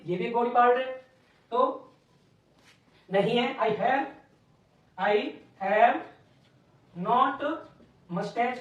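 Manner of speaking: normal talking voice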